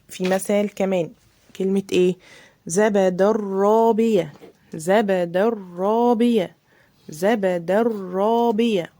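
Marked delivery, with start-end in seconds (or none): 2.7-8.9 زبد الرابية slow with a doubled ra; careful Quran reading; slow recitation. Shown as a demonstration. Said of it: "الراء لازم تبقى مشددة".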